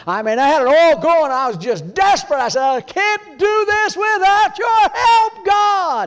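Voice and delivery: high, loud, very slow plea; desperate cry to God. Retold or imitated. Preacher reliving his plea for help, loud and slow.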